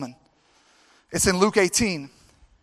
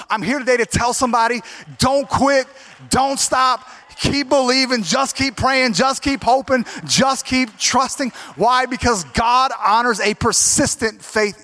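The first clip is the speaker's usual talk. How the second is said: louder, rising call to keep going; preaching exhortation; 4 semitones higher, 3 dB louder than usual. Acted. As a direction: urge the church not to quit.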